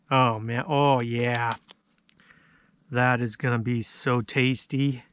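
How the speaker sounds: ordinary talk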